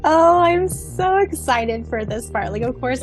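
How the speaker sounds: ordinary talk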